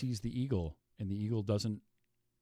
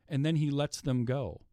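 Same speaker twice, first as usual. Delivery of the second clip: ordinary delivery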